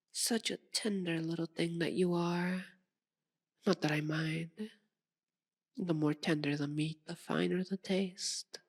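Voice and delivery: softly savouring the prey; menacing purr. Acted. Vampire eyeing tender prey, soft menace. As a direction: drop soft, relishing each phrase.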